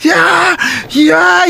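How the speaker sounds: high, repeated yelps; high-pitched mock hollers; imitated wild cries. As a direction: yelp high as if seized by it. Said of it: "e eu comecei".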